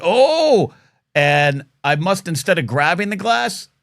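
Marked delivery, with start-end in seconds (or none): 0.0-0.7 a loud, high sudden "Oh!"; startled yelp. Retold or imitated. The glass slipping, a loud startled yelp. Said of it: "I went".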